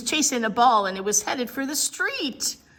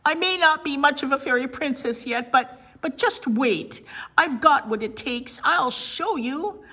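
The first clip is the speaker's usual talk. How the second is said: higher, louder, defiant; blurted outburst; 6 semitones higher, 5 dB louder than usual. Acted. Willa blurting defiance at class during spelling. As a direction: insist loudly, stumbling on but. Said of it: "she blurted out".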